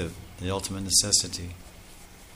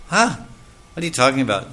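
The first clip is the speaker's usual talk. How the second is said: high, loud baffled retort; startled huh; 5 semitones higher, 6 dB louder, faster than usual. Retold or imitated. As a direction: jump up in disbelief. Nanda's surprised retort to sage.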